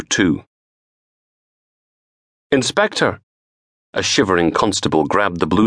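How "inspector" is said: high call across the sand. Acted. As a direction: hail the inspector. Constable calling out across beach.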